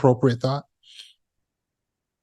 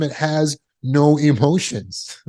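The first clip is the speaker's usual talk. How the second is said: ordinary talk, nothing marked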